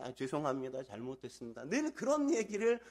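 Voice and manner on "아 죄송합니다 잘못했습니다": lightly acted meek apology, dropping low and soft; a hint of a junior backing down; cowed new employee. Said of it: "신입사원은 그냥 늘 깨갱깨갱". (기죽은 신입사원 흉내, 낮고 작게)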